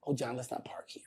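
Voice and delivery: breathy whisper, barely voiced; whispered plea. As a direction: whisper it like a shy girl in a parked car. Red Fox joke, whispered repeat.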